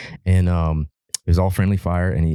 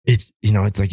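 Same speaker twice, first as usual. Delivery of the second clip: ordinary delivery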